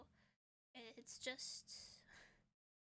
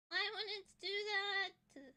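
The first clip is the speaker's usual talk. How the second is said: louder, higher frustrated complaint; exasperated; 7 semitones higher, 7 dB louder than usual. Real, not acted. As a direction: vent at the broken headphones. Headphones disconnected, frustrated.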